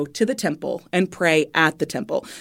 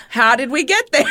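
laughing; 11 semitones higher, 3 dB louder than usual. Real, not acted. Laughing at her own confusion.

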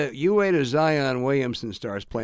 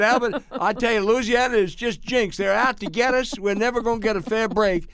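put-on high-pitched Louisiana accent, lamenting to a friend; mock doom in an imitated Louisiana accent; James Carville; 6 semitones higher than usual. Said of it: "this is my Louisiana accent".

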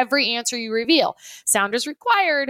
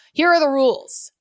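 ordinary delivery

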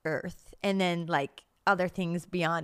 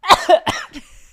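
loud cough; 9 semitones higher, 13 dB louder than usual. Real, not acted. Coughing mid-story.

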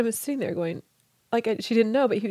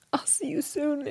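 soft whisper, far quieter than her talk; whispered promise; 3 semitones higher, 6 dB quieter than usual. Retold or imitated. Husband promising to see her again, whispered. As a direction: murmur it tenderly.

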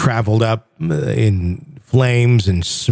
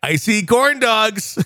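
cheesy tour-guide dad voice, much higher; Chevy Chase dad; 5 semitones higher than usual. Pretend. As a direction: play the goofy dad showing the kids around. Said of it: "in a weird Chevy Chase moment".

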